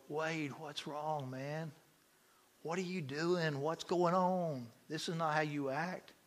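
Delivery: soft, slow, concerned questioning; gentle concern. Retold or imitated. Counselors to upset man in a side room, gentle concern. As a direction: talk him down quietly.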